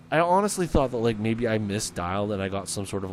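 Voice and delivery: ordinary delivery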